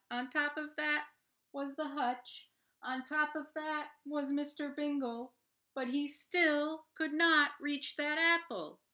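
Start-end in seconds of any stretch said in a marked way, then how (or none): 5.7-8.8 building refrain. A narrator's reading.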